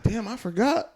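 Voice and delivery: high-pitched, rising realization; mock surprise. Answering someone with an old grudge, high surprised protest. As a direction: let it jump up high at the end. Acting own surprised reply in anecdote.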